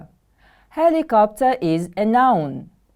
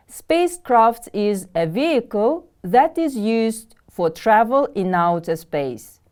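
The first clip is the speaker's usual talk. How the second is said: normal talking voice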